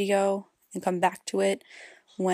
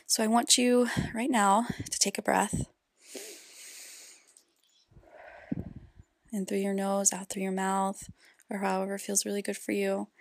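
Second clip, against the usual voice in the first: guided meditation; 6 dB quieter than usual. Acted. Host leads parents through inner child healing, guided meditation. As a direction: guide them gently inward, softly, leaving space between phrases.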